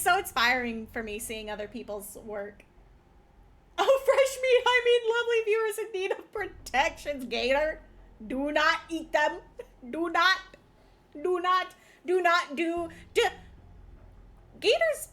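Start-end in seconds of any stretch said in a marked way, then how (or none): 3.8-7.2 high, loud hungry-monster tease; cute anime streamer. Acted. Playful menacing greeting. 7.3-15.1 playful scolding. Acted. Scolding the pet gator.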